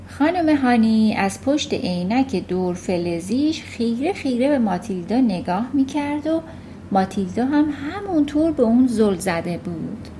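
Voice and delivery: plain reading voice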